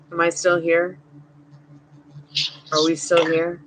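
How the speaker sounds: ordinary talk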